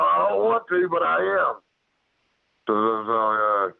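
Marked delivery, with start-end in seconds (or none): 0.0-1.5 high-pitched, insistent retold warning. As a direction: re-enact calling up a warning, pitch raised. Retold warning to creature in tree.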